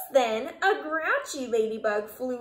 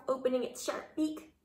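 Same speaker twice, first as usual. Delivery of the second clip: ordinary delivery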